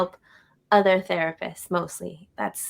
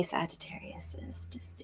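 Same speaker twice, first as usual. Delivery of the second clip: whispered; 4 semitones lower, 17 dB quieter than usual. Real, not acted. Host confides love of Sagittarius.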